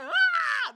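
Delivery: high hammed-up yell of "Ah!"; mock scream. Imitating Braun Breaker's scream with a high mock yell. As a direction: scream "Ah!" like a wrestler. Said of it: "He was like".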